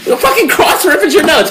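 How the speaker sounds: high, strained yell of disbelief at the writers; exasperated yelling. Retold or imitated. Podcast host reenacting his outburst at the writers, laughing exasperated yell. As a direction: shout it like you can't believe they did it again. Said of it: "And I was like".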